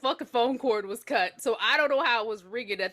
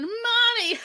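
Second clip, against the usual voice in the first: high, loud singsong call; taunting kid calling for mother; child calling out; 10 semitones higher, 4 dB louder than usual. Acted. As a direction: call it out high, mid-game. Child calling while playing hide and seek.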